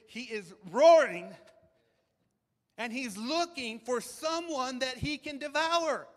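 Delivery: loud, slow preaching about the roaring lion; preacher warning the congregation about the devil; building intensity. Acted. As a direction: lean into it and slow it down.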